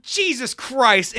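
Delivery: loud, slow exasperated groan; exasperated dad. Retold or imitated. Dad walking off from wife, slow loud groan. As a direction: drag it out, fed up. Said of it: "super fucking annoyed look".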